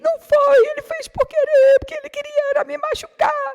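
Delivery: put-on very high, loud child's voice; five-year-old grandson protests to his grandma; protesting child. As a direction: insist like an upset little boy.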